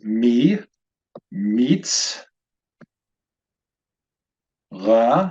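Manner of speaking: mi, meets, ra as isolated syllables with long pauses; syllable-by-syllable demonstration. Shown as a demonstration. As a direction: sound out each of mi, meets, ra alone. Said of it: "First syllable is".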